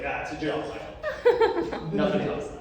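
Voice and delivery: can't hold it together on the joke; cracking up while delivering God's reply; laughing punchline. Retold or imitated. God's deadpan answer, laughing.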